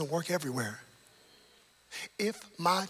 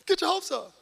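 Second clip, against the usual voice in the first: retold preaching shout; 11 semitones higher, 11 dB louder than usual. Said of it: "I told you".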